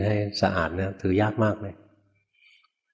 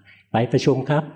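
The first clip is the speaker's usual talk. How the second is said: higher, mock-polite phone reply; raised answering voice; 6 semitones higher, 4 dB louder, slower than usual. Junior answering a caller for the boss, raised voice.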